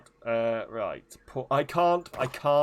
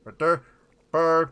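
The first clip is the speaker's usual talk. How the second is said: loud, high mock-Swedish gibberish; Swedish Chef voice; 3 semitones higher, 8 dB louder than usual. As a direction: build to punchy, chanted comic Swedish syllables. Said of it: "the Swedish Chef voice".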